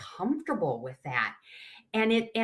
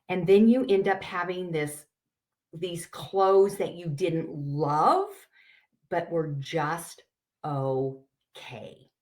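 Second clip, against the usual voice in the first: usual speaking voice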